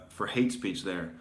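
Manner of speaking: normal talking voice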